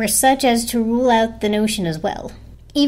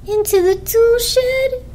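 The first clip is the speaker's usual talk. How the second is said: very high, slow, loud horrified squeal; high, shocked blanket voice; horrified shudder; 14 semitones higher, 4 dB louder, slower than usual. A narrator's reading. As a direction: recoil in horror at the thought. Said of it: "said the blanket with a shudder of horror".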